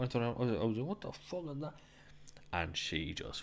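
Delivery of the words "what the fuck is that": high, hushed startled reaction. Retold or imitated. Man startled by the stall door hitting him, startled. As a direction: blurt it high but keep it soft.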